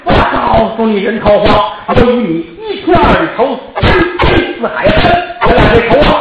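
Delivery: high, loud vow of revenge, slowing on the middle words; furious threat. Acted. Zhou Gong swearing revenge at Taohua, furious and loud. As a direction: spit out a grudge you'll never drop.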